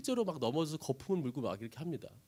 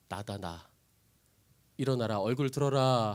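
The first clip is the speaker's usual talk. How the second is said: gentle reassurance; 7 semitones lower than usual. Acted. Jesus comforts fallen disciples.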